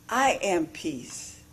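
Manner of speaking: ordinary talk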